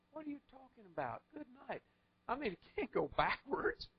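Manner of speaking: incredulous disbelief. Acted. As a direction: scoff as if it's impossible.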